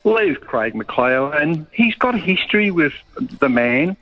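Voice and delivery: ordinary talk, nothing marked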